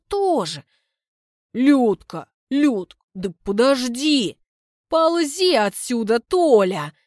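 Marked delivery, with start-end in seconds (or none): 1.5-6.9 Lyudka angrily driving him off, loud; furious woman yelling at her suitor; angry shout. A narrator's reading. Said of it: "бросает она со злостью". (злобный крик)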